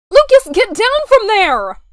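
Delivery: loud, alarmed yell upward; calling out. Acted. Mother yelling at her son to get down, loud and alarmed. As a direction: shout at the kid to climb down.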